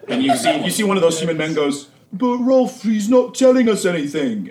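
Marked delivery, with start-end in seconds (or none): 2.1-4.5 high, whining thug voice; a dim lackey complaining to the boss; whiny henchman. Acted. Henchman whines to his boss.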